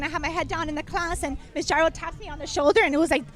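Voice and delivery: usual speaking voice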